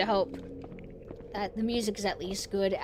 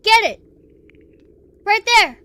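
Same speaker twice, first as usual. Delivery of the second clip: excited shouting; 12 semitones higher, 13 dB louder, slower than usual. Real, not acted. Urging dog to attack.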